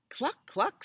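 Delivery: high double cluck; clucking cow. Acted. Cow greets Snake in a story, high clucking. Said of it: "said Cow".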